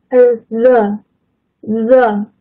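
the drawn out slowly, tongue between the teeth, voiced th; slow demo of the. Shown as a demonstration. Teacher shows students the voiced th, very slowly. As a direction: stretch the th in the so it buzzes. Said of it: "重读，the".

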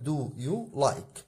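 very slow, each word spelled out for students; slow classroom dictation; slow demo. Shown as a demonstration. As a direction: draw the words out like dictating to a class. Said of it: "يبقى هنا أقول لك".

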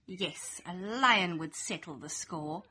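usual speaking voice

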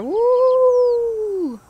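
high, loud drawn-out whoop; long celebratory "woo"; mock cheer. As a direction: whoop it up mockingly. Mock celebration of making fire.

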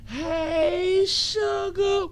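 high falsetto "Hey, sugar"; sugary falsetto greeting. Acted. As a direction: coo it up high. Signature greeting to the chat.

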